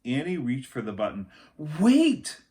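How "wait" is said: sharp high shout of warning; urgent cry. A narrator's reading. Jack stops Annie at the airlock, shouting. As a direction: shout it to stop her. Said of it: "Jack grabbed her hand".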